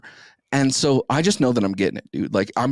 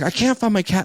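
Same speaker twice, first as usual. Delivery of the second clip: high, distressed imitation of her; a pitched-up, fretting mimic; upset girlfriend; 8 semitones higher than usual. Boyfriend imitating his upset date who can't find her cat, high and fretful.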